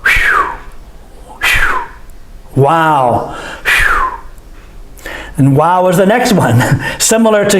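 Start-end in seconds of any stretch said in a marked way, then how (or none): 0.1-1.8 a whistled breath out; relieved phew. Shown as a demonstration. Teacher demonstrating the relief sound, whistled phew. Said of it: "Amazement or relief". 3.7-5.1 long soft breath out, unvoiced; breathy phew. Shown as a demonstration. Teacher showing relief, a long breathed phew. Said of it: "Amazement or relief".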